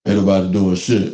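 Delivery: low, firm big-man voice; low tough warning. Retold or imitated. Big friend Kev's warning.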